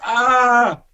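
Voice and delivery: high, drawn-out imitated "aah"; mock pain cry. Injured soldier being lifted by helpers, imitated pain cry.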